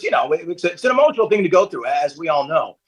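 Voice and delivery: ordinary talk, nothing marked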